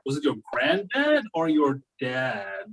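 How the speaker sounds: usual speaking voice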